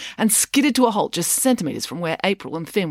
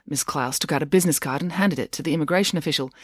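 usual speaking voice

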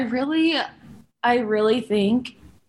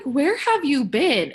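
exasperated retort; 6 semitones higher than usual. Retold or imitated. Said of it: "I was just like".

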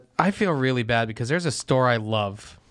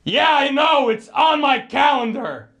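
loud, higher hammed-up retort; mock snap; 11 semitones higher, 5 dB louder, slower than usual. Mocking Mo snapping at the receptionist, loud and high. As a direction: snap it back at the caller.

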